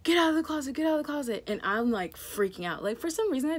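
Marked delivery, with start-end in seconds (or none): 0.0-1.4 much higher, louder barked order; retold police shout. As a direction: yell it like the cop with the flashlight. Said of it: "then he's like".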